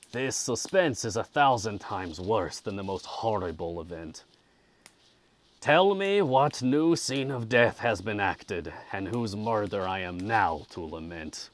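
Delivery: loud, dramatic anguished demand; agitated outburst. Acted. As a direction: plead forcefully to hear the worst. Acted sufferer demands dreadful news.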